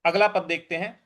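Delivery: ordinary talk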